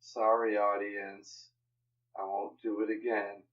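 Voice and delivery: low, soft mumbled apology; sheepish apology. Real, not acted. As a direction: apologize quietly, chastened. Sheepish apology after being scolded.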